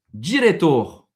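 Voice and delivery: "diretor" said loud and clear; Portuguese word. Shown as a demonstration. Teacher quizzes the student with a Portuguese word, loud clear model. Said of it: "Si je te dis".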